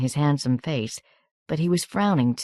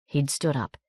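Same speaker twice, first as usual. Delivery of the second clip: ordinary delivery, nothing marked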